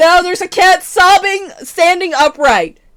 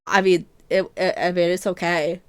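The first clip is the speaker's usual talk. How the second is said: softer, hesitant mumble; hesitant mild reply; 8 semitones lower, 9 dB quieter than usual. Retold or imitated. Retelling own reply to family member, softer and hesitant. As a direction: trail off unwilling to offend. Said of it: "I'll go, like".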